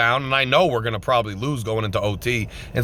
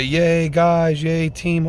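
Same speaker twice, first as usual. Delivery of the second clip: a hollow, sarcastic little team cheer; 5 semitones higher, much slower than usual. Pretend. Fan mocking the empty praise for the team, sarcastic cheer. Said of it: "we could sit here and say".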